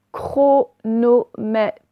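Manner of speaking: "chronomètre" very slowly, cro-no-mè-tre; "chronomètre" broken up and slow, final tre sounded; syllable by syllable. Shown as a demonstration. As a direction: dictate "chronomètre" in four separate syllables. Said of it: "Faites bien syllabe par syllabe".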